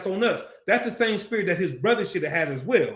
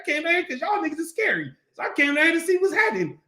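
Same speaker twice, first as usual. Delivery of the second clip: high-pitched, mock-indignant challenge; David's defiant retort; 8 semitones higher, faster than usual. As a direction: call them out in a raised, hammed-up protest. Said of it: "he like, he probably like".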